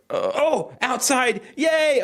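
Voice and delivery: put-on high, gleeful kid's cheer; excited child. Host playing a child let out for recess, high cheer. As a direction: squeal with joy at recess. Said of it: "the mind of a child".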